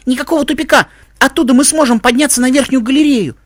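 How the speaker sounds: put-on high, insistent child's voice; arguing boy. Tim contradicts Mike about the dead end, high insistent boy. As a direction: argue back in a high boyish pitch.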